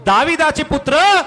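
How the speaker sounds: high, drawn-out call for help; crying out. Retold or imitated. Preacher acts blind man calling to Jesus, crying out. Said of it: "ओरडून त्याने विनंती केली".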